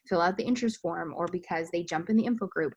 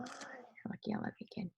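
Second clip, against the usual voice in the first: whispering; 5 semitones higher, 16 dB quieter than usual. Real, not acted. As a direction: lean in and whisper. Whispers to her child.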